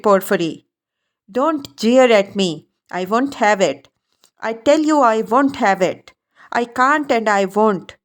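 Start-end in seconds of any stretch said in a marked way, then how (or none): none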